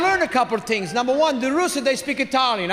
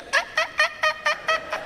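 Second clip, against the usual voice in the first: very high, thin repeated squeak; imitated squeaking noise; 4 dB quieter, much faster than usual. Storyteller mimicking the dolphin-like noise, high squeaks. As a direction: squeak like a panicking animal. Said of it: "It sounds like it's a panic".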